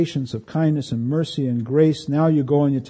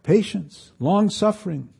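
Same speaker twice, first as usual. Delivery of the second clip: softer, tender plea; Paul's quiet correction; gentle counsel; 3 semitones higher, 5 dB quieter than usual. Retold or imitated. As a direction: lay it down gently. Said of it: "He says".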